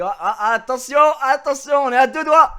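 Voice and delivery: high and loud teasing warning; mock warning. Teasing the nervous banker about filming him, loud mock warning. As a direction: wag a finger at him, loud and playful.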